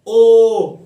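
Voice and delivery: ओ held long and called out loudly; long loud vowel. Shown as a demonstration. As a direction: stretch ओ to show two beats. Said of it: "अधिक मात्रा का समय लगता है". (ओ को ज़ोर से लंबा खींचें)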